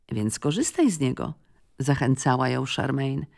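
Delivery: usual speaking voice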